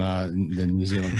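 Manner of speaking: ordinary talk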